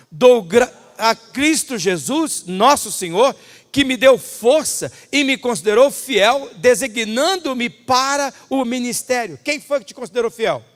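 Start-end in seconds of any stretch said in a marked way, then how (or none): 0.1-9.4 slow congregational reading. Shown as a demonstration. Pastor leads congregation in slow unison reading. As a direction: read slowly together, louder, word by word. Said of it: "Vamos ler juntos? Vamos lá".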